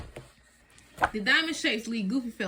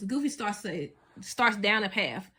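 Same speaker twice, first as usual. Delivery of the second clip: usual speaking voice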